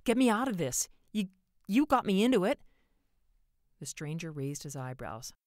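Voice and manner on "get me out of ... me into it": high, loud desperate outburst; desperate demand. A narrator's reading. Man to stranger on the bridge, desperately accusing. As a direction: demand rescue, accusing him loudly.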